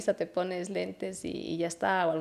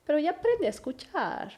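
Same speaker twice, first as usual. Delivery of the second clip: put-on higher, childlike scolding; little son's voice; 7 semitones higher than usual. Mother imitating her son telling her to learn to listen, higher childlike voice. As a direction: mimic a small boy telling mom off.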